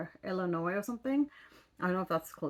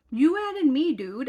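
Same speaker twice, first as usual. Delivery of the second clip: raised, much higher and louder, leaning on you; exasperated retort; 7 semitones higher, 9 dB louder than usual. Retold or imitated. Repeating to the app guy who wanted an intro, exasperated and loud. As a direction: call him out hard, like it's obvious. Retelling a standoff with her dating-app match.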